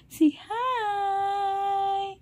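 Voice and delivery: high, sing-song parent voice. Real, not acted. Parent prompting baby to greet.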